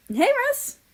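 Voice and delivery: very high, loud cheerful call. A narrator's reading. America calls to Russia in the garden, loud and bright. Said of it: "I called".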